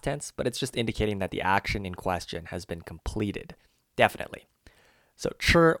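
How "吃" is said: "吃" held high and level in first tone; first tone chi. Shown as a demonstration. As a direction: sing "吃" on one flat high pitch. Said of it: "was in first tone, if you could hear that".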